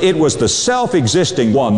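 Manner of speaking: ordinary talk, nothing marked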